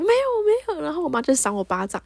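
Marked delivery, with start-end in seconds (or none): 0.0-0.8 high mock denial; retold defensive protest. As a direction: plead innocence in a pitched-up voice. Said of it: "我就在那边辩解说".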